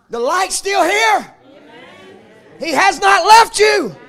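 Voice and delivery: loud, ringing pulpit cadence, each phrase hammered home; full-force preaching. Acted. Preacher to his congregation, full-force shouted preaching. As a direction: preach it like a revival climax. Preacher rising again to comfort.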